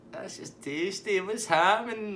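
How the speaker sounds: high, drawn-out self-introduction; Tasty Dave. Retold or imitated. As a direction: announce yourself in a high party-guy voice. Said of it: "He's like".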